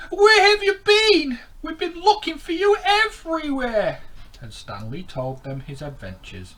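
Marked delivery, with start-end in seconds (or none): 0.1-4.0 friend fish. Acted. Friend greeting lost Stanley.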